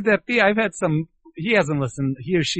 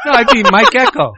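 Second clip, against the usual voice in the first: excited correction; 8 semitones higher, 10 dB louder than usual. Real, not acted. Excited correction of own initials.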